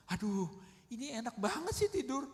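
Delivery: high-pitched exasperated grumble; retold annoyance. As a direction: re-enact grumbling at someone fast asleep. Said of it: "saya kesel sama kayak murid-muridnya". (gerutuan kesal yang ditirukan, bernada tinggi)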